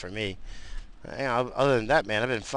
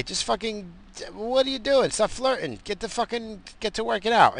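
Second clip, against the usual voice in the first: annoyed rant; 12 semitones higher than usual. Retold or imitated. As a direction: bark at them to quit flirting and train. Ranting at the two gym guys.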